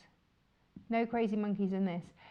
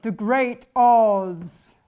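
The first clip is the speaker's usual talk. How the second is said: much louder, slow and grand, like a wizard proclaiming; booming Great Oz voice; 11 dB louder, much slower than usual. Acted. Host showing fabric, announcing the Great Oz in his grand booming voice. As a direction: announce him the way the Great Oz would. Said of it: "You have to say it in that voice".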